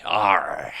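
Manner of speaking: drawn-out, loud pirate "arr". Shown as a demonstration. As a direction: give a cartoon pirate's "arr". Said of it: "talk in the stereotypical pirate accent".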